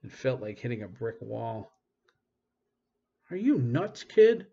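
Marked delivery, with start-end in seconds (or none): 3.3-4.5 high, loud incredulous yell; angry shout. A narrator's reading. Lyle yelling at the boy who knocked him down, high and loud. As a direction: bark it at the reckless kid.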